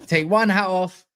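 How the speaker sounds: ordinary delivery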